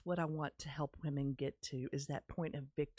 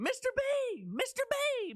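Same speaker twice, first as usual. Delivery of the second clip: high, eager call to the teacher; excited calling out; 5 dB louder than usual. Retold or imitated. Girl running into the drama room calling her teacher, high and eager. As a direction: call him urgently, pitched way up. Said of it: "I came running into the drama room".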